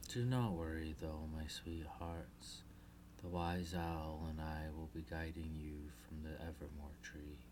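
soft, low reassuring mother; comforting. Acted. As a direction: hush and soothe the little ones. Queen Selena reassures daughters.

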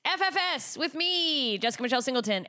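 loud, slow showbiz announcer; announcer intro. Acted. Host opening her podcast, loud slow announcer voice. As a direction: boom it out like a host opening the show.

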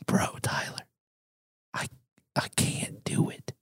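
hushed, breathless whisper of defeat; whispering friend admits he can't go through with it; whispered quote. Retold or imitated. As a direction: whisper it like a confession in the hallway. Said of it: "You're like".

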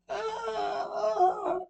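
long high-pitched scream; piercingly high, sustained "ah". Acted. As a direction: scream in fright in the dark. Sudden fright in the dark.

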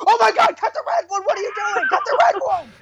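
high, panicked mock scream; frantic imitation of someone yelling orders. Imagined wife at the bomb wire-cutting, mock screaming. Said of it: "Just screaming in your face".

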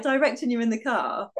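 normal talking voice

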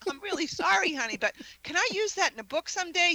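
high-pitched, wheedling request; mock-contrite, then angling for a favor; cheeky apology. Retelling her cheeky apology to husband.

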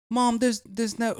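high-pitched mock choked child; trying to talk while gagging. Tricked kid to mom, trying to talk while gagging. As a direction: imitate a kid struggling to speak through gags. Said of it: "she keeps, like, gagging".

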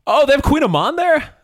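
high, loud delighted surprise; thrilled foodie squeal; excited. Real, not acted. Delighted the pastry is there.

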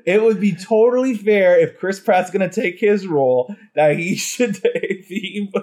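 talking through laughter, slow; laughing. Real, not acted. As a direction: defend your joke while cracking up. Laughing while defending own joke.

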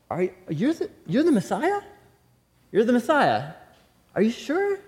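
incredulous, pitch leaping up at the end; doubting question. Acted. Acting out John's doubting question.

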